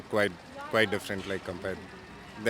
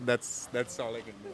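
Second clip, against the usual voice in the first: normal talking voice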